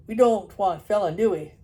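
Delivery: loud broad Yorkshire farmer. Acted. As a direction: say it like a knowing dalesman. Farmer continues.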